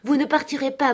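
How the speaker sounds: high-pitched, insistent protest; insistent refusal. A narrator's reading. Catherine refuses to let him go. (refus insistant, voix haute)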